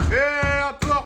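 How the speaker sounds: loud high call through the door. Acted. Drunk son calling to be let in at four in the morning.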